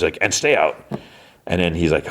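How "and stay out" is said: usual speaking voice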